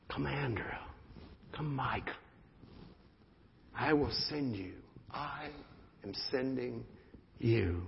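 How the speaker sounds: low, hushed divine call; quiet, tender voice of God; soft call. Acted. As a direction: call each name gently and low. Voicing God calling, hushed.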